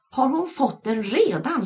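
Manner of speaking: put-on high, slow, eager child voice; excited shriek. A narrator's reading. Janna shrieking at the teacher about the baby, excited. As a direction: blurt it out. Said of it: "skrek Janna".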